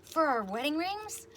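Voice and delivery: high, slow, thrilled question; son-in-law hoping the dish is for both, high and slow; excited son-in-law. Retold or imitated. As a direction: ask it bright-eyed and eager. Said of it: "He's like".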